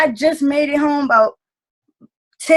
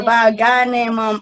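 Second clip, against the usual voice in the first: ordinary talk, nothing marked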